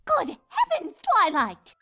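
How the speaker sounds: loud, shocked exclamation; gasping. Acted. Rarity alarmed for Twilight, gasping in shock. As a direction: gasp it out in alarm. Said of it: "gasped Rarity".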